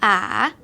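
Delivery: A with a dipping, rising third tone; full third tone. Shown as a demonstration. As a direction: dip low then rise on A. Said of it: "Original for third tone pronounced like this".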